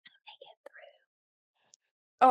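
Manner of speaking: barely audible, choked-up whisper; tearful whisper. Real, not acted. Asked for words to whisper to a NICU mom, whispering. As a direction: whisper it through real tears. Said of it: "you would whisper in their ear".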